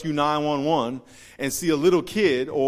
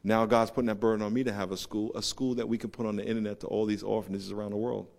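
ordinary talk

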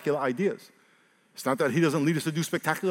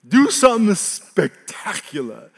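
mocking, drawn out; 4 semitones higher, 5 dB louder, slower than usual. Shown as a demonstration. Devil's taunt repeated.

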